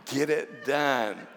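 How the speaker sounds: drawn out and laughing; slow emphatic order. Retold or imitated. Retelling girlfriend's order slowly, emphatic and laughing. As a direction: spell it out word by word. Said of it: "she just looks at me and she goes".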